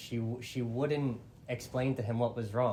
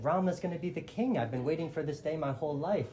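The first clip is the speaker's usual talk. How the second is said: higher, gushing retold excitement; happy excitement, pitched up and quickening; excited; 6 semitones higher, faster than usual. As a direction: play someone thrilled by long-awaited news. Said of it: "very excited, very happy".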